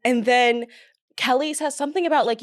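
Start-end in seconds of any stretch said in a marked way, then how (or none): none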